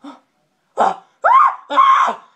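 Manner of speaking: gasps building to a high, loud cry; imitated frightened gasping; panicked gasps. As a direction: pant "ah" in rising alarm.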